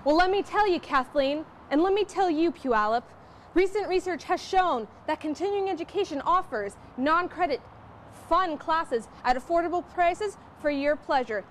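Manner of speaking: high, loud, breathless field report; hammed-up TV reporter; mock breaking news. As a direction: shout the scoop like it's huge news. Mock reporter shouting the scoop.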